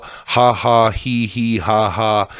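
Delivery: spoken-out mock chuckle, syllable by syllable; fake laughter. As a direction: fake a polite ha-ha, hee-hee. Mocks rich people pretending to be friendly.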